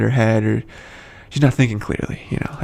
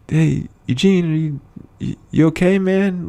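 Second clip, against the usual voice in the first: higher, slow, careful check-in; retold concern; 7 semitones higher than usual. Friends checking on injured Eugene, gently concerned. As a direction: ease into it as if humoring him. Voices others doubting Eugene's mind.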